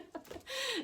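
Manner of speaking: high burst of laughter. Real, not acted. Laughing at her own tears over the lost seat.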